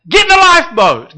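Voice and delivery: high, loud plea; urgent call. Acted. Preacher pleading with the congregation, a loud call. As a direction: cry it out to the whole room.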